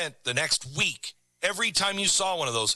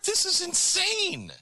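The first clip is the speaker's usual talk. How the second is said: much higher, slow, stunned; mock amazed Italian; slower than usual. Host imitating the Italian amazed by free refills, much higher and slow. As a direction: stretch it out in wide-eyed disbelief. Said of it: "He's like".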